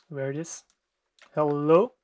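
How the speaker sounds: ordinary talk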